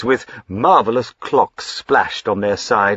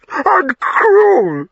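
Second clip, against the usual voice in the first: even higher, breaking into a sob; sobbing cry; 7 dB louder than usual. Acted. Wife sobbing at her husband's harshness, tearful wail. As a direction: wail it through tears.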